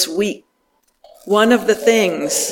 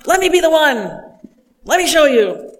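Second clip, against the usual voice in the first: much higher, eager let-me-do-it; eager Peter; 8 semitones higher, faster than usual. Retold or imitated. Peter eager to walk on water, excited and high. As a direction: jump in eagerly to show off. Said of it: "whose initial response is always".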